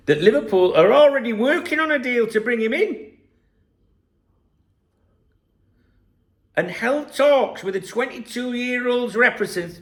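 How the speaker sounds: over-the-top exclusive reveal; mock news announcement. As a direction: announce it like a big scoop.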